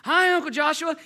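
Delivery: high, loud excited boy imitation; mock kid yell into the phone; shouting over others. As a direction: holler a greeting over the grown-ups. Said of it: "over the top of Papa and Daddy".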